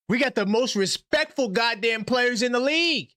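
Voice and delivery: high, mocking echo of a caller; parroting the caller in disbelief; mocking quote.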